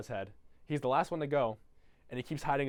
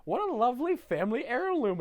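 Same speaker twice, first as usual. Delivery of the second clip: high, loud sugary voice; high gushing child's voice; mock sweet kid; 11 semitones higher, 4 dB louder than usual. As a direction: gush it like a delighted child. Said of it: "one of the words he goes is".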